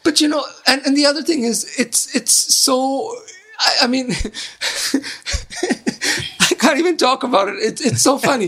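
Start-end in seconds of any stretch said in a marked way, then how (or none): 4.2-5.6 breathy burst of laughter, high; cracking up mid-sentence; helpless laugh. Real, not acted. Critic laughing at the hero's plight.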